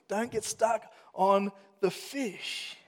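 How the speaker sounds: "don't get stuck on the fish" slowed right down; slow emphatic warning. Real, not acted. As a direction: lean on each word to drive it home. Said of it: "closing exhortation".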